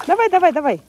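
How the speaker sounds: high, loud cheering on; eager urging. Real, not acted. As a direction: egg the dog on, excited. Urging dog to swim.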